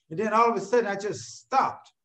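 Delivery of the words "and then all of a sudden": ordinary talk, nothing marked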